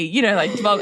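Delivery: ordinary talk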